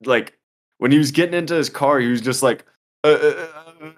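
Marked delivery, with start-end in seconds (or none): 3.0-3.9 high stuttering uh sounds, scared; imitating frozen stutter. The scared attacker unable to answer, stammering. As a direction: stammer like a man frozen in fear. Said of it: "he couldn't talk".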